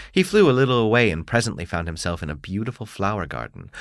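plain reading voice

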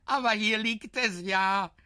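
put-on high, loud delighted child's voice; a small boy pleased to help; cheerful little boy. A narrator's reading. Boy finds horn, cheerful.

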